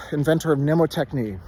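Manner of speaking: ordinary delivery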